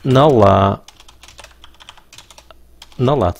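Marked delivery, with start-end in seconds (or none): none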